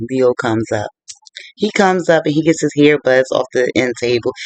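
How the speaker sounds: ordinary delivery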